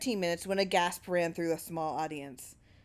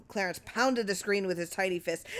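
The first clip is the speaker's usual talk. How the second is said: ordinary delivery, nothing marked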